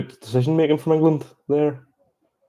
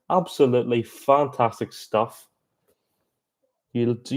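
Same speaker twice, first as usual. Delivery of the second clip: ordinary delivery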